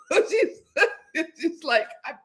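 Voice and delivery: real amused laughter about Greg; laughing. Real, not acted. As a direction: giggle as you recall it. Laughing at the memory.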